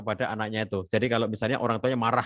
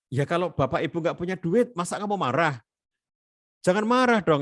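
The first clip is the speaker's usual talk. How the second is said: dismissive scolding; 6 semitones higher than usual. Shown as a demonstration. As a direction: brush off the child's anger, then snap. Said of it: "itu namanya enggak mengakui".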